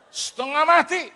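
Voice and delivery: high, slow, loud outcry; emphatic exclamation. Real, not acted. Pastor complaining about the stink, slow loud exclamation. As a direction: drag it out at full volume in comic disgust.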